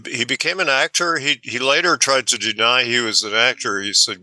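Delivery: ordinary talk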